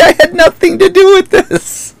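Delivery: laughing through a denial; chuckling defensive protest. Real, not acted. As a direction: protest innocence while still laughing. Denying blame amid teasing.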